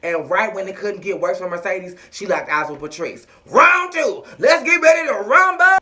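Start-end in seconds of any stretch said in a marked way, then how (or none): 3.5-5.8 high, loud ring announcer call; boxing announcer. Pretend. Recapper announces the jail rematch like a ring announcer.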